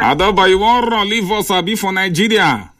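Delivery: mock accusation, bright and high; playful high teasing. Host teasing caller about leaving, high playful voice.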